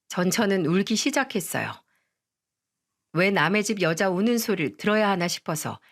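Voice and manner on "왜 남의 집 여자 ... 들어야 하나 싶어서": ordinary delivery, nothing marked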